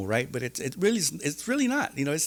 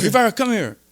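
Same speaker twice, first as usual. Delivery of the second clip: higher, louder summons; mock counselor call; 11 semitones higher, 8 dB louder than usual. Counselor calling the inmate in, raised voice. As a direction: call him over like a counselor. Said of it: "He goes".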